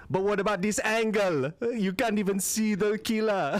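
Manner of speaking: put-on relaxed Jamaican accent, slow; lightly voiced Jamaican juror. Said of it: "Jamaican twelve Angry Men".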